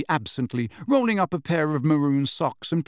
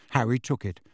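usual speaking voice

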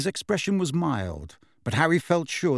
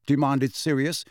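plain reading voice

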